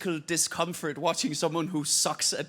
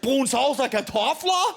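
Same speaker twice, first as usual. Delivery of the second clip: higher and louder, hammed-up weary Dane moaning; a family pleading for English then chanting a dance song; mock Danish family, hammed-up and loud; 4 semitones higher, 6 dB louder, slower than usual. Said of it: "does she think Danish people".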